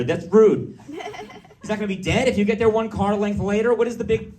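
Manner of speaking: high, loud shouting tirade; road-rage rant. Retold or imitated. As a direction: blow up at the other driver, outraged. Acted road-rage rant at driver.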